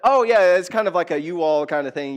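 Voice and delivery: loud, high start settling down; breezy explaining. Retold or imitated. Pastor explaining "yuns" to old friends, loud breezy reply. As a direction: jump in loudly to explain it away.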